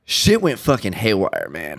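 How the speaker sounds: normal talking voice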